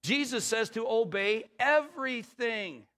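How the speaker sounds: high and loud, slow, pounding each word; preaching at full force. Acted. Pastor exhorting the church, high loud preaching. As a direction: drive the point home to the pews.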